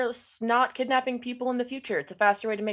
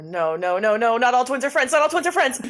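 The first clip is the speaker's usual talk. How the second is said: louder, rising panicked no's; panicked protest; 3 semitones higher, 7 dB louder than usual. Acted. Atticus dodging a hug from strange twins, loud panicked protest. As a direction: back away yelling in alarm.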